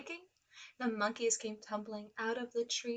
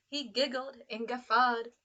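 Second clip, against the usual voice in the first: ordinary delivery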